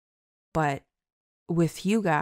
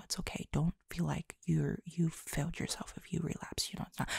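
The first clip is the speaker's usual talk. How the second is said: whispered; 8 dB quieter, faster than usual. Real, not acted. Gentle reassurance to viewers.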